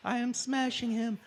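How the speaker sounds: squeaky high-pitched taunt, imitating the fighter; mock trash talk. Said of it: "You were like".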